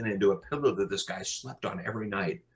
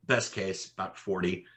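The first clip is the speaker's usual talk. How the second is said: softer, level restatement of Best case; quiet repeat; 6 dB quieter than usual. Shown as a demonstration. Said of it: "quiet repeat of the same phrase".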